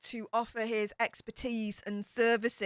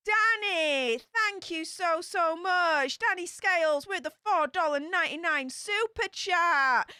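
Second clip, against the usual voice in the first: excited thank-you; 6 semitones higher than usual. Real, not acted. Streamer thanking Danny for his super chat, excited and high. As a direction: sing out the donor's name with delight.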